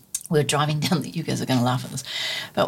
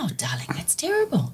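breathy, whispered sympathy from a gentle grandmother; hushed grandma; 2 semitones higher, 4 dB quieter than usual. Retold or imitated. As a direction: murmur it like a kindly old grandma. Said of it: "And she goes".

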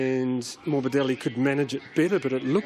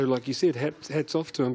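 ordinary talk

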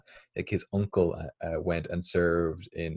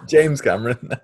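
loud, higher, words through laughter; laughing; 8 semitones higher, 10 dB louder than usual. Real, not acted. Co-host fixing his name mix-up, laughing. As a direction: crack up while correcting yourself. Cracks up at own David Cameron slip.

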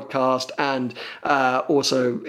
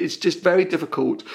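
ordinary talk

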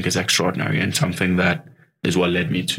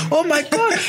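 high excited yell, lighter than the first; roommate's shriek. Retold or imitated. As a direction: echo the roommate's joyful scream. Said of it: "I hear him".

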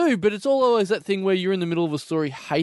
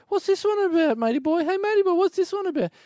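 high nasal-free squeaky pester, much higher than his own voice; pestering weirdo customer; 13 semitones higher than usual. Retold or imitated. Comic shop weirdo pointing at every comic, high pestering voice. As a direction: needle the shop guy in a high silly voice. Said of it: "So every day".

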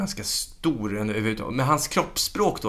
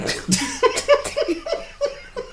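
högt, pipigt skratt; gapskratt. Real, not acted. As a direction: skrattar ut helt. Roas åt skämtet. (ljust, befriat skratt)

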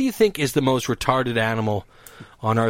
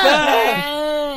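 goat scream imitation; 11 semitones higher than usual. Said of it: "they scream like".

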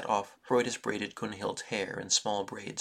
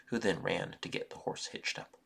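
plain reading voice

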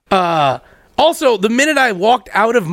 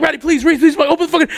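pleading; 7 semitones higher, faster than usual. Retold or imitated. Begging roommate to open door.

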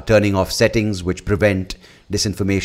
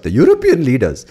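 "European" in an Indian accent, raised higher; 6 semitones higher, 6 dB louder than usual. Shown as a demonstration. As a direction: lift the voice on "European leaders", Indian style. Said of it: "you have to get that little voice up".

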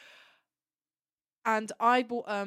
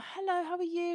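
high, eager retold hello; greeting him; 11 semitones higher than usual. Fan greeting Sylvester at his table, high retold hello. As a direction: act out a nervous fan's high-pitched greeting. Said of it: "and I'm like".

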